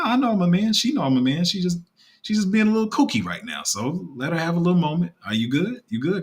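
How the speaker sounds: ordinary delivery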